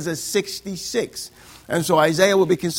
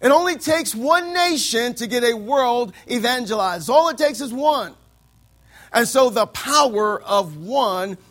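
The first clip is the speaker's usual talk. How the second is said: fervent preaching; 3 semitones higher, 4 dB louder than usual. Acted. Preaching at full force.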